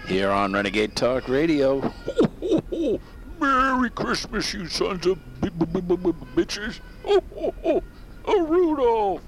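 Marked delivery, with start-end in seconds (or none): 2.0-3.4 high hearty Santa laugh; big jolly laugh; Santa belly laugh. Acted. 3.4-6.8 high jolly Santa voice. Acted. Santa character greeting. 6.9-8.2 Santa laugh. Acted. 8.3-9.2 Santa voice. Acted. Santa calls reindeer.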